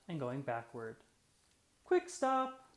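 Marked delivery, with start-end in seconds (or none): 1.9-2.7 much higher, louder sharp call; sudden command. A narrator's reading. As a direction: snap it out like a driving instructor. Driving lesson command.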